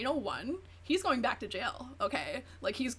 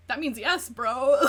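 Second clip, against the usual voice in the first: louder, hitting bro hard; loud exasperated; 9 dB louder than usual. Real, not acted. Vlogger scolding the evasive boyfriend, loud and exasperated. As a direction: call him out like a fed-up friend. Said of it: "ranting at the dodge".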